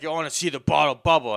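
loud taunt. Retold or imitated. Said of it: "and he's like".

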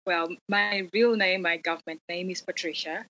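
ordinary talk

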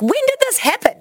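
very high mock bafflement; incredulous. Wife imitating her husband catching on years later, high-pitched incredulity. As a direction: imitate a husband's indignant disbelief.

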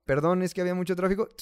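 rattled off fast apology; rushed excuse. Retold or imitated. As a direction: gabble the excuse quickly.

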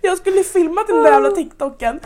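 ordinary delivery

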